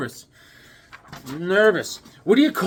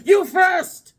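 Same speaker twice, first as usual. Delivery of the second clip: loud high snapped retort; rude comeback shout; 14 semitones higher, 6 dB louder than usual. Retold or imitated. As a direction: throw it back at him hard. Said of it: "cab driver snaps back".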